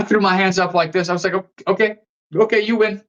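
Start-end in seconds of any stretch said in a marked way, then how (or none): 1.3-3.0 retold surrender. Backing down, hands up.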